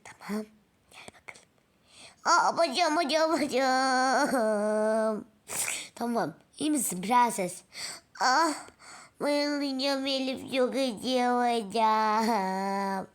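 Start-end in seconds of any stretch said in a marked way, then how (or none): 2.2-13.1 loud, drawn-out cries of pain; pretend pain cry. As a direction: wail about the hurt leg like a child. Doll hurts her leg.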